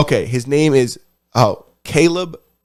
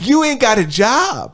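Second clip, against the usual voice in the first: mock scolding; 9 semitones higher, slower than usual. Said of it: "Motherfucker, you have".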